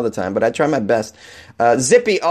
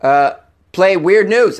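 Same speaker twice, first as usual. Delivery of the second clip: slow device command; 4 semitones higher, slower than usual. Shown as a demonstration. Host demonstrating a voice command to Alexa, slow and careful. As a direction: drag it out so the machine gets it.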